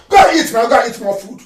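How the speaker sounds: usual speaking voice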